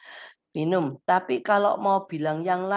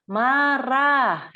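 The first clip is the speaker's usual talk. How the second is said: "Marah" louder and higher, clear; one-word label; 6 semitones higher, 4 dB louder than usual. Shown as a demonstration. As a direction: give the single word with weight. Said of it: "ya satu kata".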